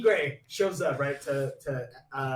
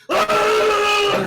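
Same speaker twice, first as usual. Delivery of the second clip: long, high mock battle scream; imitated metal scream, held at full volume; anime-style scream; 12 dB louder than usual. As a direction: scream it like Dragon Ball. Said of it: "he's screaming like he's in Dragon Ball".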